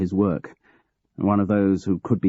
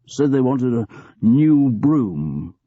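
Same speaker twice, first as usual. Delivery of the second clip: slow, louder, defiant; truculent, slow; 5 semitones higher, 4 dB louder, slower than usual. Acted. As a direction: spit out the words with sour defiance.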